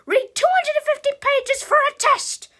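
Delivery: high, full-volume teacher yell; screeching mean-teacher order. A narrator's reading. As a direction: yell the assignment at the class. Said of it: "yelled at us all the time".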